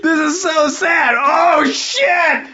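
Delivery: howling with laughter; laughing shout. Real, not acted.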